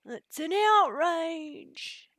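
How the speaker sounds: high, very slow, drawn-out cry of indignation; hammed-up high comic outrage; Mighty Boosh quote. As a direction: stretch every word of the outrage way up high. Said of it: "in the Mighty Boosh is".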